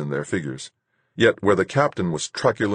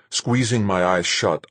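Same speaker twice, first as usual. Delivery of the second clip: plain reading voice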